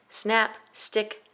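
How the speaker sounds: ordinary delivery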